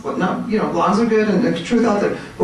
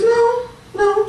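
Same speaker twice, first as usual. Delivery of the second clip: imitating her little daughter; 13 semitones higher than usual. Mother voicing her little daughter.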